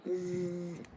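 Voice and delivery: low, soft snoring sound; soft snore. A narrator's reading. Maid fast asleep on the tall bed, snoring softly. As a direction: snore gently as she drifts off.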